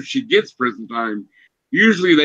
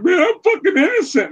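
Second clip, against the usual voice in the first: high whiny imitation of a crying new inmate; mock sobbing protest. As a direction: pitch it way up, whimpering. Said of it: "He's like".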